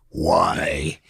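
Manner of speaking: low, flat deadpan drop on the single word; a sudden low, dry, unimpressed one-word put-down; Robert Mitchum's dry why. Retold or imitated. The older star shooting down a young hopeful in a low deadpan. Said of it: "he looks at me and he goes".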